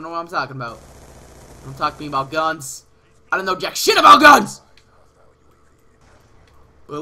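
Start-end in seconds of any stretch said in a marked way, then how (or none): 3.3-4.6 yelling in frustration; frustrated shout. Real, not acted. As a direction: shout it out, fed up. Frustrated gamer yelling at himself.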